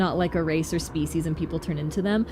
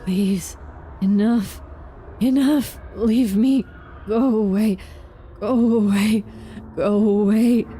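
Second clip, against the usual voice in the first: pleading; 2 semitones higher, slower than usual. Acted. Villager begs stranger to leave.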